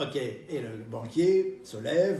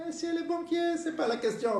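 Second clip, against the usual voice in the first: put-on old lady voice; 12 semitones higher than usual. Old lady scolds banker.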